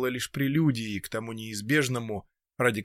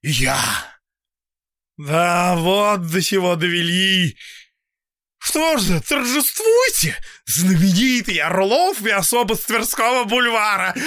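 high, loud, bitter yell; bitter shouting; 7 semitones higher, 5 dB louder than usual. A narrator's reading. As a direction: hurl it at your enemies. Old man's bitter outburst.

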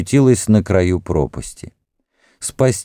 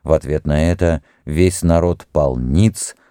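ordinary delivery, nothing marked

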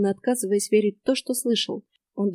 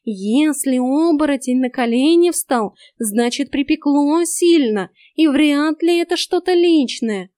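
forced calm; 5 semitones higher, 6 dB louder, slower than usual. A narrator's reading. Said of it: "пытаясь говорить спокойно".

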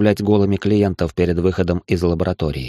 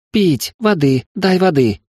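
much higher, desperate little voices; begging children; 10 semitones higher, 3 dB louder, slower than usual. Acted. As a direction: cry out for water like needy kids. Child creatures beg for water.

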